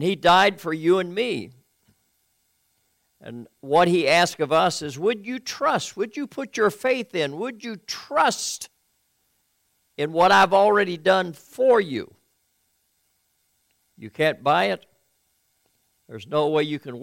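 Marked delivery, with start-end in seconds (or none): 5.0-12.1 pleading question. Acted. Preacher pleading with listeners.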